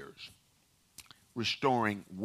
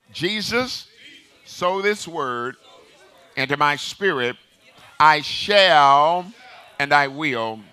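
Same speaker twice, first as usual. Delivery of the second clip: loud, raised preacher's declaration, phrase by phrase; declaring with conviction; 6 semitones higher, 11 dB louder than usual. Real, not acted. Preacher leading the church's Bible confession, loud emphatic declaration. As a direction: proclaim it like you mean it, pausing for the congregation to repeat. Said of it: "say it like you mean it".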